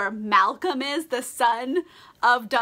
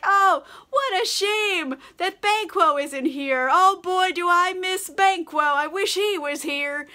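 slow, higher, hammed-up fake grief; mock sorrow; 7 semitones higher, 4 dB louder, slower than usual. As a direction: overplay missing your murdered friend. Macbeth fakes grief at dinner.

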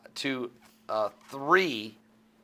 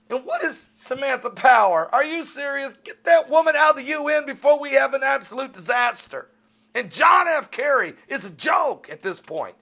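loud, high indignant shouting; angry outburst; 10 semitones higher, 4 dB louder than usual. Real, not acted. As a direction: rail against them at full force. Rant against Samantha Power, Kerry.

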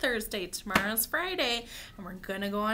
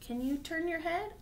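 ordinary talk, nothing marked

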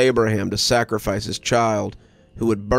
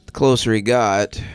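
plain reading voice

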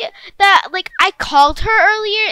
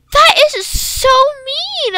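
high, slow, tearful complaint; hurt whimper; 7 semitones higher, 4 dB louder, slower than usual. Acted. Maddie, hurt, answers her friend's insult. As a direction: say it close to tears.

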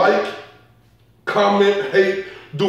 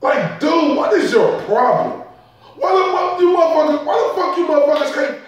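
exasperated rant; 6 semitones higher than usual. Real, not acted. Ranting at trainers' stupidity.

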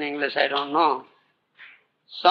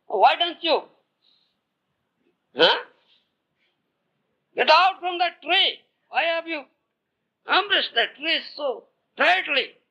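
high exasperated scolding voice; scolding king; 12 semitones higher than usual. Acted. King telling the sadhu to let go, exasperated high scolding. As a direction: scold him to let go, voice pitched way up.

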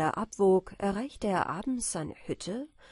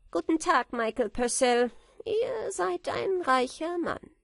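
mocking farewell; 7 semitones higher, 3 dB louder than usual. A narrator's reading. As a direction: sneer a farewell. Zwerg verabschiedet sich höhnisch. (höhnischer Abschied, höher)

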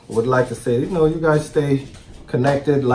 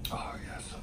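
breathy whispered delight; 21 dB quieter than usual. Real, not acted. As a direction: whisper it, lost in the taste. Savoring between bites.

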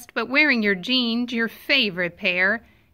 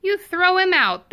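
higher, louder punchline; emphatic raised refrain; 6 semitones higher, 4 dB louder than usual. A narrator's reading. Reader answering the refrain to children, louder and emphatic. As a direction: proclaim it with gusto.

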